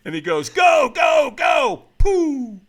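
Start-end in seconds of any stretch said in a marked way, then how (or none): 0.5-1.8 high, loud repeated yell; imitated trainer screaming the start; trainer's urging shout. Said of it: "he screamed at me". 2.0-2.6 loud, high take-off noise; high whooshing launch effect; imitated whoosh. Imitates himself taking off.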